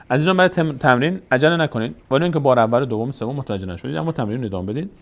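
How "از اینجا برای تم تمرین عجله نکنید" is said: usual speaking voice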